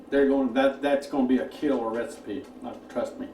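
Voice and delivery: ordinary delivery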